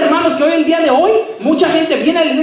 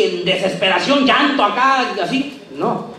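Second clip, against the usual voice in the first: usual speaking voice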